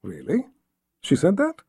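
soft, rising disbelief; hushed double-take, lifting at the end; surprised. A narrator's reading. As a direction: question it, taken aback. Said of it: "Richard pulled back in surprise".

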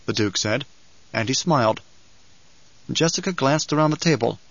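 ordinary delivery, nothing marked

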